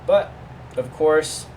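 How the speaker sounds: ordinary delivery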